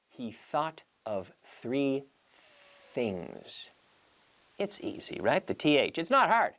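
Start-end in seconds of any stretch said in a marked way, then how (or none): 0.1-3.7 "He thought of three things" very slow, each th voiceless; slow th drill. Shown as a demonstration. Teacher modelling a voiceless th sentence slowly for practice. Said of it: "Try that at home".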